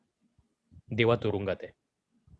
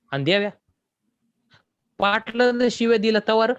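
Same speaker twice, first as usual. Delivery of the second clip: much higher, challenging question; higher, teasing villager; 9 semitones higher, 4 dB louder than usual. Acted. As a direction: needle him, pitch rising. Villager asks Deva.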